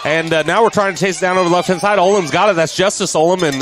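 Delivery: high and loud, racing after the loose ball; excited play call. Real, not acted. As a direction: chase the fumble with your voice. Fumble chase called live.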